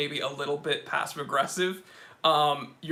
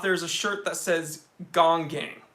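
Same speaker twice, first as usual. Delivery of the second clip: normal talking voice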